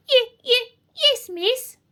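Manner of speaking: high, stuttering little werewolf; nervous stammer. A narrator's reading. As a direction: stammer timidly to the scary teacher. Frightened pupil answers witch teacher.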